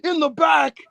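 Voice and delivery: high, loud hammed-up complaint; mock promo rant. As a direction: imitate a wrestler's indignant promo. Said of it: "it was a lot of".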